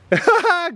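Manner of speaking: high burst of laughter; real high giggle; amused laugh. Real, not acted. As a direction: laugh out at the dog's tumble. Dog tumbles, speaker laughs.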